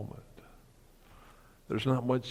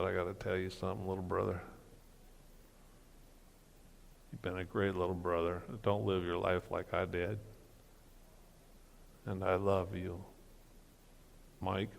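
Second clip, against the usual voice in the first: softer, tender last words; tender farewell; 2 semitones lower, 8 dB quieter, faster than usual. Retold or imitated. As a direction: say it gently, as if fading out. Dying words to little brother.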